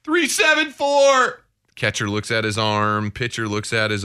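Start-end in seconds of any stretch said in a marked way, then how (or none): 0.0-1.4 high, slow barked numbers; coach calling signals. Retold or imitated. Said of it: "a coach is going".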